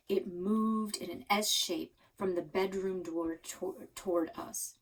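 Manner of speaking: usual speaking voice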